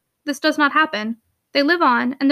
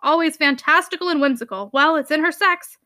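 mocking exclamation; 3 semitones higher than usual. Uncle ridiculing his niece's longing for change, mocking. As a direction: scoff at a feminine whim.

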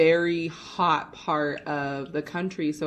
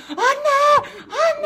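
high, loud panicked scream of a name; screaming for help; 7 dB louder than usual. Retold or imitated. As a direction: yell for your sister, terrified. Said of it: "she was screaming bloody murder".

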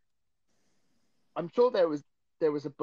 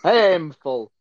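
laughing; 12 dB louder, much slower than usual. Real, not acted. Laughing at the bad accent.

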